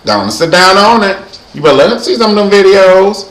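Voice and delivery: high, loud comic telling-off; mock scolding. Calling out [name] over banning TV, mock scolding.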